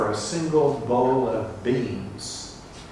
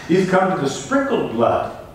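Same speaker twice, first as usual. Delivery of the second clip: full-force preaching; 6 semitones higher, 4 dB louder than usual. Acted. Preacher to congregation on coming to Zion, full-force preaching. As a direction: ring it out like a preacher at the peak.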